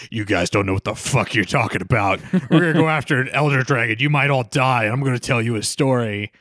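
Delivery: put-on gruff old hand lecturing the rookies; mock world-weary veteran, pitched a little down. Aiden in the corner warning boastful hunters, world-weary and gruff. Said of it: "world weary and gruff".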